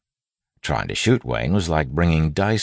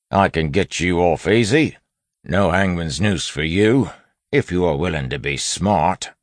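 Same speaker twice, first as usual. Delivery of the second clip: lightly voiced constable, raised and louder; constable interrogator; 4 semitones higher, 5 dB louder than usual. A narrator's reading. Wayne as constable offering a bandit a deal, constable interrogator. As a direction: press the prisoner with a constable's offer.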